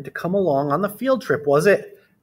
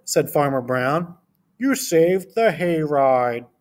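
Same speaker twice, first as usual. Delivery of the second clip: put-on reading to children. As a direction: read it to a class of small kids. Reads picture book aloud.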